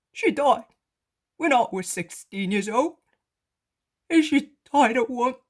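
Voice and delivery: a poor man pouring out his family wrongs; beggar confessing, anguished. Acted.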